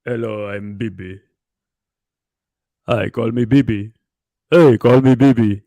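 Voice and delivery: a hint of a deep-voiced leader, building to a loud call; imitating Bibi's deep voice. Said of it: "He has the deepest voice".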